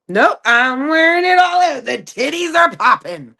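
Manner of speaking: high, loud and slow, full of sass; sassy defiant reply. Retold or imitated. Answering a controlling guy, loud sassy defiance. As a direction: throw it back at him with attitude.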